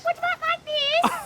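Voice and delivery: very high, thin put-on voice; too high voice. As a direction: squeak it way up high. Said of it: "Too eunuch".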